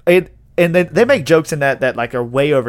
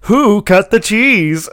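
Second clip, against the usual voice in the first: punchline reveal; 7 semitones higher, 4 dB louder, much slower than usual. Retold or imitated. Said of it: "He goes".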